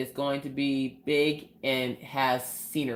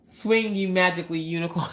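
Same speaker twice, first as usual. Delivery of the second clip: playful shout; 3 semitones higher than usual. Real, not acted. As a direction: cheer it out playfully. Cheering on a viewer's clip.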